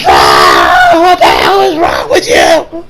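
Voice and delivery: high, drawn-out mock scream; outraged scream. Senator screaming at Dio, hammed-up outraged scream. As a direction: wail it slowly, cracking up.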